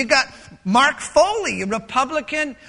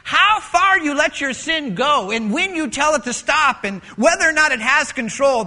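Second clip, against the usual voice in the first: ordinary talk, nothing marked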